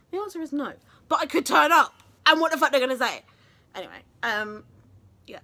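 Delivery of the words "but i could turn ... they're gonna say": loud, punched-out defiance; defiant outburst. Real, not acted. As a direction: raise your voice as if daring them. Defiant outburst at casting rules.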